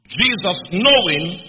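loud, slow swell on "knowing"; stress on knowing. Shown as a demonstration. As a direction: drive "knowing" home, drawn out and forceful. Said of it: "underline knowing".

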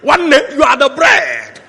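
high, loud mimicked cheering; mock-adoring shout to the pastor; excited congregation. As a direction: shout it like an over-eager church crowd. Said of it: "they were saying".

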